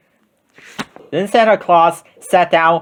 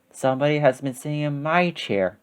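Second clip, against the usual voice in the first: much softer Mama Bear voice; middle-sized voice; 9 dB quieter than usual. A narrator's reading. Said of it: "in her middle-sized voice".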